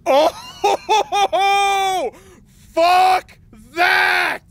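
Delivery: high, loud shocked yell with a laugh; startled laughing shout. Real, not acted. Jumpscare by marionette.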